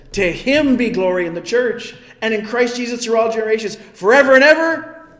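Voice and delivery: loud, rising scripture proclamation; preaching. Acted. Pastor proclaiming Paul's doxology to the congregation, loud and rising. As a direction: build it up to a ringing forever and ever.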